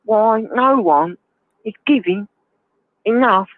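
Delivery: mock Russell Brand, whining about the poor; Russell Brand impression. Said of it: "that's really good impression".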